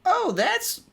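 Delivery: a high, lightly Southern take; an accidental Southern twang on the line; southern accent slip. Shown as a demonstration. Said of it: "it keeps going in a friggin' southern accent".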